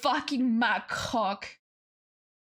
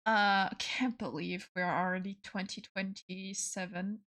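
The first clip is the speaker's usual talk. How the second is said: ordinary delivery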